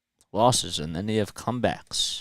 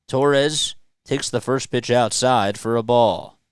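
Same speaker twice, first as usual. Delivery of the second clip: play-by-play; 4 dB louder than usual. Acted. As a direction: announce each pitch like a broadcaster. Live play-by-play call.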